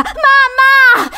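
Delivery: very high, very loud cry; terrified scream. Acted. Boy fleeing down corridor calling his mother, terrified scream. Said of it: "gridò".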